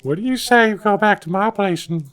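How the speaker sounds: high put-on creepy voice; creepy old man. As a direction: leer like a dirty old man. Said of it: "Now you sound like Herbert".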